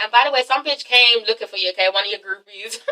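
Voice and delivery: ordinary talk, nothing marked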